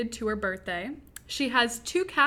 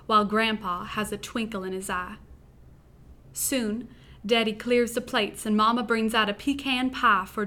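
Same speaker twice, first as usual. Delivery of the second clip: put-on Southern accent. Podcast host reads her wedding-eve story to listeners in a Southern accent. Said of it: "I will be reading my first-person story in a Southern accent".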